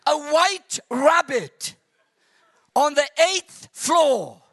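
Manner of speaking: slow, high, loud reveal; slow punchline. Real, not acted. Preacher reveals the absurd rabbit to the crowd, slow and loud. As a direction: drag out the punchline.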